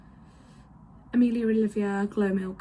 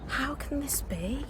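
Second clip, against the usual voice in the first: whispering; 5 semitones higher than usual. Real, not acted. Complaining about the heat.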